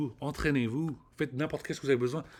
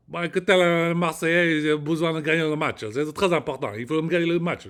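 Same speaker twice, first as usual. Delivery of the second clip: loud, higher, bad Marseille accent; attempted Marseille accent; 5 semitones higher, 8 dB louder than usual. Shown as a demonstration. Rennes native trying to mimic Marseille fans, poor Marseille accent. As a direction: push out a hammed-up Marseillais accent at full voice. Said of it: "faire un accent marseillais. Ok, je vais essayer".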